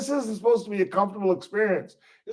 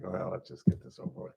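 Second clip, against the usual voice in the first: much lower, softer, half-whispered; resigned mutter; 12 semitones lower, 14 dB quieter, faster than usual. Retold or imitated. Himself being arrested, quietly resigned. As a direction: give up calmly. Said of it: "I was just like".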